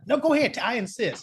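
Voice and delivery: imitating the mob guy pushing food, pitched well up; lightly hammed insistent host; high, coaxing offer. Mob patient urging nurse to take it, high coaxing.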